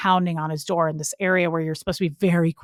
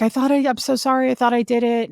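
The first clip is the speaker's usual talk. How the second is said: pleading, higher-pitched retold apology; high pleading voice, imitating herself; frantic apology; 5 semitones higher, 3 dB louder, faster than usual. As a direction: replay a desperate, rushed-out apology. Pleads apology to screaming boss.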